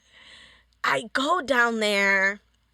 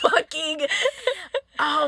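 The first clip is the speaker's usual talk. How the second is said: higher, laughing through the word; 8 semitones higher, 4 dB louder than usual. Real, not acted. Guest laughing while retelling the teachers. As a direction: laugh while trying to talk.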